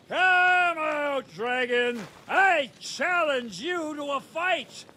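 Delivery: shout at top of lungs. A narrator's reading. Saint George challenging the dragon into the cave, full-throated shout. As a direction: roar it into the cave like a brave knight. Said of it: "he shouts at the top of his lungs".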